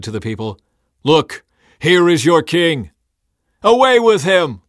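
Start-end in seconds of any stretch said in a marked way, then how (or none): 1.0-2.9 slow, high, loud announcement; proclaiming. A narrator's reading. Pilate to the people, proclaiming. 3.6-4.6 high, loud crowd cry; yelling. A narrator's reading. Crowd rejecting their king before Pilate, yelling. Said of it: "they yelled".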